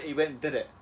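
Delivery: ordinary talk, nothing marked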